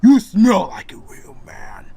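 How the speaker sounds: loud, slow menacing beast voice; growling wolf man. Acted. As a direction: sniff out your prey, threatening. Wolf man recognizes Thor.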